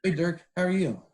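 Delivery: retold excited fan greeting; starstruck greeting. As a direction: call an eager greeting up to someone towering. Calling up to towering player.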